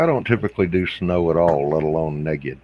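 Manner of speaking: ordinary talk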